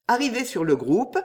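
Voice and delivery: plain reading voice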